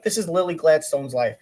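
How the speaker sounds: normal talking voice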